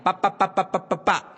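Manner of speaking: put-on playful staccato pa-pa-pa to a baby; syllables popped one by one; babbled syllables. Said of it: "y le decía".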